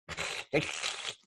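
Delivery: airy burst of laughter; breathy laugh. Real, not acted. Laughs at his own story.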